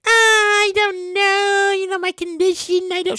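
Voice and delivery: slow, drawn-out reluctant voice; slow whiny excuse. Retold or imitated. Daughter-in-law dodging the park trip, slow reluctant whine. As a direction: stall like you can't be bothered. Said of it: "She was like".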